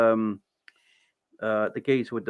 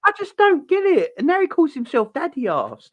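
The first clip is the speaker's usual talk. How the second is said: high-pitched, louder incredulous rant; exasperated bafflement; 13 semitones higher, 4 dB louder than usual. Real, not acted. Ranting to co-host about Billy Gunn, exasperated bafflement. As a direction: protest it high, unable to understand.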